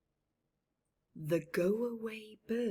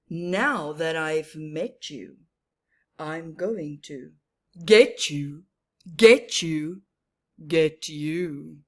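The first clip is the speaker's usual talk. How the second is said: menacing Get You bird; 5 semitones lower, 9 dB louder than usual. Acted. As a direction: growl the threat at her.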